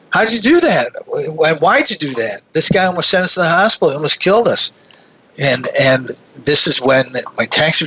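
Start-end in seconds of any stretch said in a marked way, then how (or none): 0.1-4.7 retold yelling out. Passenger to his taxi driver after a near crash, mock yelling out. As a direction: act out shaken disbelief, pitch jumping up. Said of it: "I sort of yell out".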